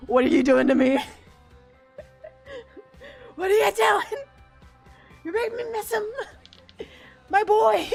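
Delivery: high, loud fangirl wail; mock anguished wail. Streamer wails over a beloved lost character, mock anguish. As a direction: cry out in hammed-up heartbreak.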